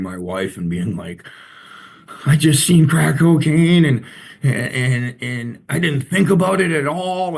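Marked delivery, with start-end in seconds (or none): 2.2-7.4 high, loud burst of distress, then faltering; retold tearful call. Telling wife about seeing crack cocaine, retold as a tearful outburst. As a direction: pour it out high and upset, stumbling on "and". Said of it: "just bawling my eyes out".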